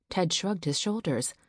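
ordinary delivery, nothing marked

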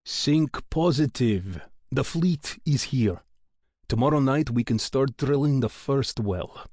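slow, upbeat Russian engineer; rolling Russian accent. A narrator's reading. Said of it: "Yevchenkov's rolling accent".

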